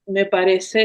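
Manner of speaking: ordinary talk, nothing marked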